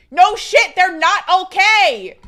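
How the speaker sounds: loud, high, emphatic rant; mock exasperated outburst. Streamer ranting about being asked if okay, mock exasperated. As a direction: burst out loudly in playful outrage.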